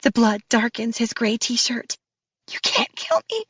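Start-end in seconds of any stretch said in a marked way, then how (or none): 2.5-3.5 high, strained rasp. Acted. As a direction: force it out through a tight throat. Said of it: "I rasp".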